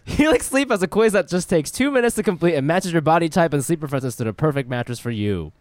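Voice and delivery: smooth sponsor read voice. Acted. Host reading the mattress sponsor ad to his guest. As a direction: sell the mattress plainly and brightly.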